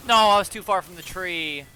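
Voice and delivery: frustrated outburst. Real, not acted. Frustrated after a lost fight.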